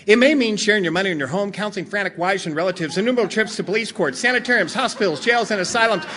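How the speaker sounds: rapid-fire rattled-off reading; fine-print disclaimer. Acted. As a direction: race through it like an ad's legal warning. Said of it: "I find the fine print".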